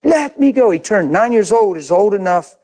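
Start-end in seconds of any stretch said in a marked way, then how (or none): 0.0-0.7 put-on much higher, louder child's yell; higher and louder retold yell; louder boy's scream. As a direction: shriek it like a frantic child. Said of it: "He's never treated me like that".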